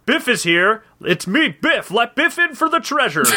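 hammed-up impatient announcing; insistent hero. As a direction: demand to be let in, building to a high finish. Hero demands entry, knocking harder.

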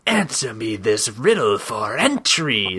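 low, slow snake-head voice; ominous talking knocker, deep and slow; riddling door knocker. Acted. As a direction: pose a riddle as a solemn guardian, drawn out. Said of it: "the right head spoke".

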